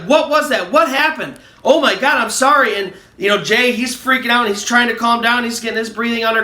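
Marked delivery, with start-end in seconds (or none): 0.1-2.8 high-pitched, rattled questioning, mildly yelled; retold frantic yelling. Ryan freaking out at Jay after the scare, acted frantic yell. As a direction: imitate someone freaking out, voice pitched up. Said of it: "he's just yelling at Jay".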